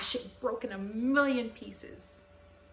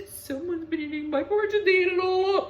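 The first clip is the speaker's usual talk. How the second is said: much higher, louder crying whine; high blubbering baby bear; whiny baby bear; 10 semitones higher, 11 dB louder than usual. A narrator's reading. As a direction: wail it like a sulky little cub. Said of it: "Baby Bear was quite the whiner".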